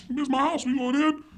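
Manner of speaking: high-pitched imitation of defiant dad; dad's bold declaration. Dad leading the way into boarded-up house, defiant. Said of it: "He's like".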